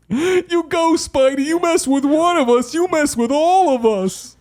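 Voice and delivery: very high, loud shouting crane; crane impression. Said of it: "People want the crane impression".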